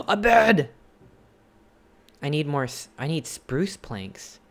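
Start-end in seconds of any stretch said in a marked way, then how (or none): 0.0-0.7 high, loud gasped delight; excited. Real, not acted. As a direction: gasp with joy. Gasp at finding bed.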